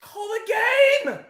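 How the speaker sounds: high, loud victory yells; slow, strained cry for the whistle, then a joyful roar; ecstatic screaming. Real, not acted. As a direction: scream as the final whistle goes. Final whistle, team goes through.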